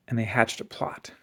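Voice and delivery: usual speaking voice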